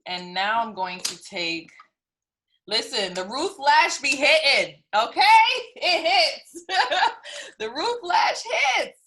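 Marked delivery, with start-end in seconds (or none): 2.7-9.0 climbing higher with laughter; excited. Real, not acted. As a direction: hype it up, giggling. Gushing over lash, rising laughter.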